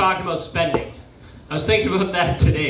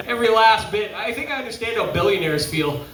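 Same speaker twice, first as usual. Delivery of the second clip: ordinary delivery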